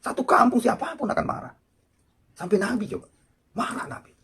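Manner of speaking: loud, high impassioned sermon; preacher heating up over the sinner's deed; fiery preaching. Acted. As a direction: preach it out at full pitch. Preacher rising to full force.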